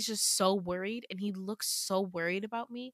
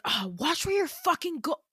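higher-pitched annoyed snap, cut off; irritated outburst; 8 semitones higher, 3 dB louder than usual. Acted. Damien to the girl who bumped into him, irritated outburst. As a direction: act out a startled, irritated complaint. Acted outburst after a collision.

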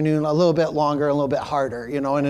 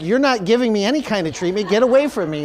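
higher, louder retold brush-off; irritated refusal; 5 semitones higher, 4 dB louder than usual. Bleeding customer to store worker offering treatment, irritated retold refusal. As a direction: fend off the helper in mock outrage.

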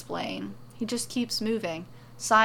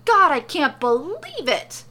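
high, loud, frantic amazement; overexcited theatrical shouting; astonished yelling; 9 semitones higher, 7 dB louder than usual. A narrator's reading. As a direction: yell as if you can't believe it. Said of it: "He splays his arms out and yells".

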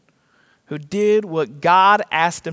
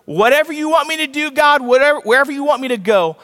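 raised, impassioned vow; fervent pledge; 7 semitones higher than usual. Acted. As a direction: pledge it to God, pitched high. Acting out a pledge to God.

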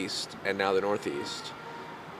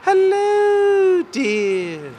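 put-on much higher, louder sweet old-lady falsetto; Mrs Doubtfire; 8 dB louder, much slower than usual. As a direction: coo it like a doting granny. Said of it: "he would do Missus Doubtfire".

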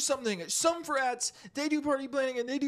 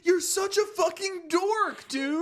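loud exasperated insult; 6 semitones higher, 6 dB louder, slower than usual. Acted. Mocking friend.

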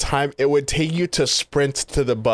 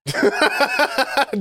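high laugh; 13 semitones higher than usual. Real, not acted. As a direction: crack up laughing. Big laugh.

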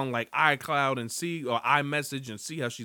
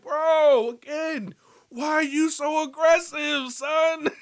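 much higher and louder "son"; exasperated shout; 12 semitones higher, 7 dB louder, slower than usual. Retold or imitated. Host yelling at the stalker character, high and loud. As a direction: yell at him to chill.

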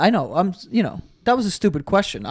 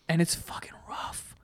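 whisper; 12 dB quieter than usual. Real, not acted. Aside about hard yoga.

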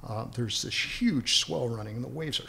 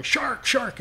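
much higher, mock yell of "Shark, shark,"; imitating a lookout's alarm cry; retold yell; 12 semitones higher than usual. Guard on the boat warning the swimmers, a mock yell. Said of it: "the guy's yelling".

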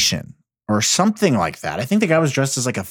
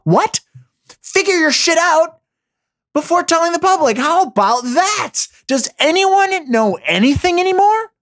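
exasperated rant; 14 semitones higher than usual. Real, not acted. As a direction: throw your hands up in disbelief. Ranting at health experts.

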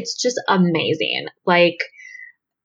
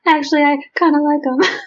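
mock kid voice; 8 semitones higher than usual. Voicing the kid's reply.

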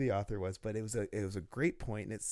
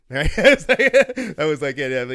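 laughing loudly and high; loud laughter; 5 semitones higher, 20 dB louder than usual. Real, not acted. Laughing at his me-no-read story, loud and high. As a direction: crack up while talking.

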